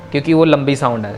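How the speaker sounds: ordinary delivery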